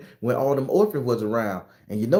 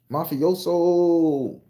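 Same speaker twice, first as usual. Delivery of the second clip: drawn-out Mafioso; 7 semitones higher, 4 dB louder, much slower than usual. Acted. Dramatic mafia word, drawn out.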